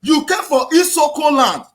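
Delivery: usual speaking voice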